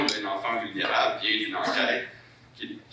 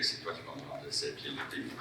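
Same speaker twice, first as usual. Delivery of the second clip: normal talking voice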